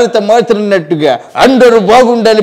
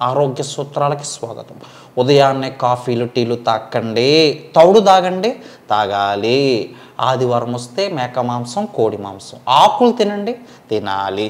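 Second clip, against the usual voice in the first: lower, softer instructing voice; mimicked health guru; 7 semitones lower, 6 dB quieter than usual. Mimic gives health tips in a lower, softer voice. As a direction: hand out health tips like a TV diet expert.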